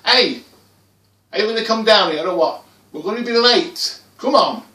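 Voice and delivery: loud, high impatient call; impatient parent's yell; dad shouting. Acted. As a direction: shout up the stairs, hurrying the child along. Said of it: "I heard him shouting for me from downstairs".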